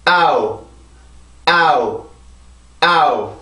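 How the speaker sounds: 아우 chanted as one stressed beat; rhythm drill vowels. Shown as a demonstration. Teacher drilling the rhythm for students, steady chant. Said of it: "다시 한번".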